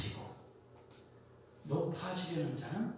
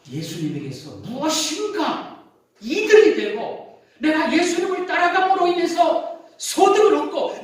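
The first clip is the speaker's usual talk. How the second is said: much higher and louder, hammering the point; preaching at full force; 13 semitones higher, 12 dB louder than usual. Acted. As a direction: preach it hard to the congregation. Preacher raises voice mid-sermon. (높고 크게 몰아치는 설교)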